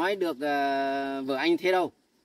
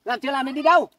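scolding; 8 semitones higher, 7 dB louder, faster than usual. Acted. Husband scolds maid leaving.

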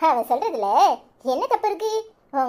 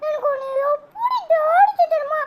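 crying; 11 semitones higher than usual. Acted. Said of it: "அழாத".

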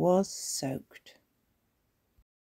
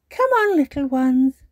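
higher, louder granny voice; coaxing granny; 9 semitones higher, 9 dB louder than usual. A narrator's reading. Granny Pig to little ones at bedtime, coaxing. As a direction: beckon the little ones to bed. Granny calling children to bed.

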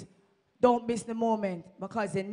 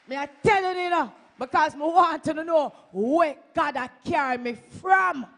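loud testimony; 5 semitones higher, 9 dB louder than usual. Real, not acted. Testifying loudly to the congregation.

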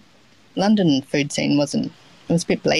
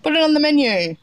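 much higher-pitched mock chef's order; retold eager instruction; 11 semitones higher than usual. Chef to young cook after hearing about laksa, eager. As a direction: imitate an eager boss deciding instantly.

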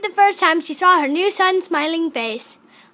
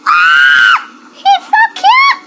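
much higher, much louder squealing shout; excited yelling; 11 dB louder, slower than usual. Real, not acted. Gamer first seeing her adopted Sims baby, excited yelling. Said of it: "Sorry that I'm yelling".

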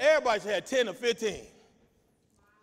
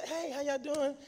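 a hint of a chatty church sister, much softer; lightly voiced friendly invite, quiet; soft, easy pitch to a stranger eating; church member inviting; 2 semitones higher, 7 dB quieter than usual. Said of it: "They eating and say".

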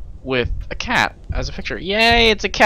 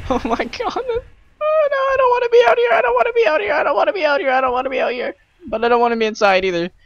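terrified horror victim; 3 dB louder, faster than usual. Real, not acted. Terrified alone outside in the dark.

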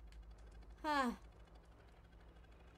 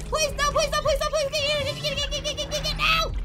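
high, loud desperate pleading; anguished denial; 9 semitones higher, 11 dB louder than usual. Real, not acted. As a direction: beg frantically, screaming. Begging while chased in game.

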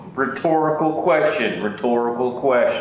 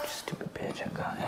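whisper; 18 dB quieter than usual. Real, not acted. Whispered aside after bite.

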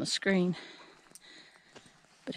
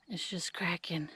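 whispering; 10 dB quieter than usual. Real, not acted. Looking at dry ground.

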